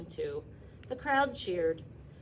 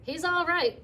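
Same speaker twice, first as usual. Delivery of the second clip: high, loud reassuring cry; cried out; 10 semitones higher, 9 dB louder than usual. Acted. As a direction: call out fast to calm everyone. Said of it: "cried Mister Zuckerman".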